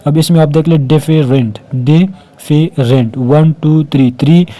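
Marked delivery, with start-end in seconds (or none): 0.9-1.6 different in three beats, डि-फे-रेंट; split into syllables. Shown as a demonstration. Teacher showing three vowel sounds, different split as डि-फे-रेंट. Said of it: "तीनों के अलग-अलग sound है". 1.8-3.2 different very slowly, डि, फे, rent with gaps; split into syllables. Shown as a demonstration. Teacher counting syllables, different slowly broken as डि-फे-रेंट. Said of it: "तीनों के अलग-अलग sound है".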